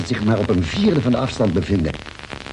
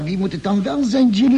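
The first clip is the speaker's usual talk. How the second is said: alarmed question; 9 semitones higher than usual. Acted. Alarmed question about the mystery caller.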